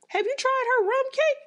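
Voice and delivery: high, bright eager-to-please coworker voice. Retold or imitated. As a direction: pitch it way up, pushing the cake on the boss. Coworker eagerly offering boss cake.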